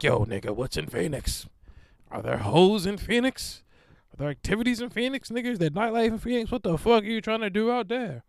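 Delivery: high, loud mocking friend quizzing him. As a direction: clown the plan like a nosy buddy. Friends mocking the Phoenix trip.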